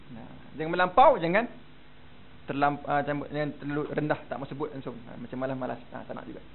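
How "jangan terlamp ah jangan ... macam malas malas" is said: usual speaking voice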